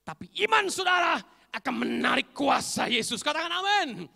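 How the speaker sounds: shouted, high preaching climax; full-force preaching. Acted. Preacher urging the congregation to say amen, shouted preaching. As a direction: proclaim it loudly to the whole congregation.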